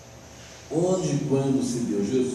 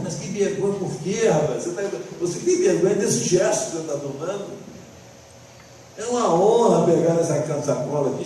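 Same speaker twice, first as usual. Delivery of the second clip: mock reproach; 5 semitones higher, 6 dB louder than usual. Acted scolding of his younger self.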